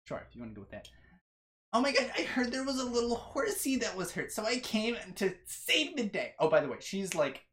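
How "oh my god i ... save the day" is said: put-on high-pitched, girlish character voice; gushing little heroine. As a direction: gush in a high voice as the rescuer.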